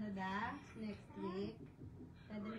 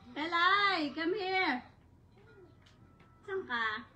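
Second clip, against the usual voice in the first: calling out; 6 semitones higher, 11 dB louder, slower than usual. Real, not acted. Calling Telay to come over, loudly. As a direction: call her over loudly.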